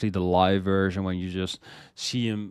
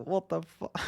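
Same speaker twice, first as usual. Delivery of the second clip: much higher, stunned; amazed disbelief; 9 semitones higher, 5 dB quieter than usual. Retold or imitated. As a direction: gasp it out in awe. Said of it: "I'm like".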